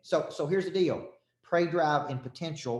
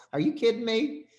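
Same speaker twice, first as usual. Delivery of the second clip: much higher, laughing incredulity; like someone who can't believe the question; amused disbelief; 9 semitones higher, 3 dB louder than usual. Retold or imitated. Tyler Perry laughing at the worry.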